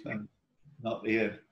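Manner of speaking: ordinary talk, nothing marked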